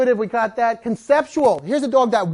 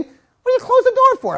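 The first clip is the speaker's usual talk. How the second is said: much higher, louder put-on dog voice; indignant dog; 5 dB louder than usual. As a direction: squeal it as the fed-up dog. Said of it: "She's saying".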